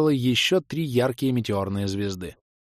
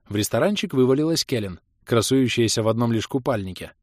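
ordinary delivery, nothing marked

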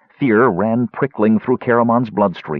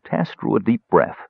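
plain reading voice

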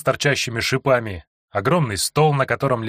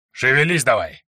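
gruff guard. Acted. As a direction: shove him along with a bark. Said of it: "толкал стражник". (грубый стражник подгоняет)

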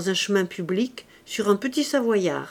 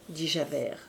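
usual speaking voice

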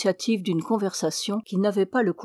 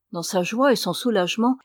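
ordinary delivery

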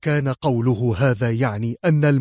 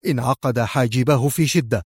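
ordinary delivery, nothing marked

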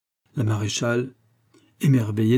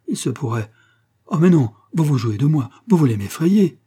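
loud, higher protest; alarmed exclamation; 5 semitones higher, 6 dB louder than usual. A narrator's reading. Adam suspects he is being tricked, exclaiming in alarm. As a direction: build from disbelief to agitated protest. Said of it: "s'écria Adam".

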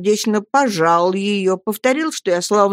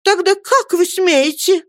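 high, slow, louder indignation; indignant; 13 semitones higher, 4 dB louder than usual. A narrator's reading. Mother challenges Peggotty, high and indignant. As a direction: demand it, offended. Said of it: "начала матушка".

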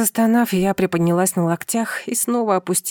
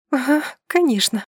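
higher, scoffing agreement; sarcastic; 6 semitones higher than usual. Acted. Wife scoffs at husband's optimism, sarcastic. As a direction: agree while plainly disbelieving. Sour reply, then displeased look.

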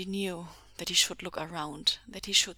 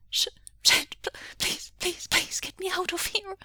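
high, broken, stammering plea; terrified whimper; 12 semitones higher, 5 dB louder than usual. A narrator's reading. Starved boy begs rescuers through terror, whimpering. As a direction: beg through terror, stuttering. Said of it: "he whimpered".

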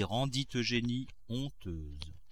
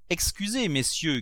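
higher call to the visitors; host calling to friends at the door, raised; 7 semitones higher, 3 dB louder than usual. A narrator's reading. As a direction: call out an apology from the stairs. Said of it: "cria Grandet".

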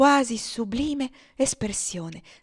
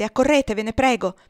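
ansiosa, un po' più forte e alta; urgent call; 2 semitones higher, 8 dB louder than usual. Acted. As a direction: chiama il cugino con urgenza. Ofelia chiama St. Clair in ansia. (chiamata urgente al cugino)

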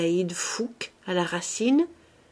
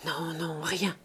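much softer murmured denial; 2 semitones lower, 7 dB quieter than usual. A narrator's reading. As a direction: brush it off under the breath. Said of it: "murmura le docteur".

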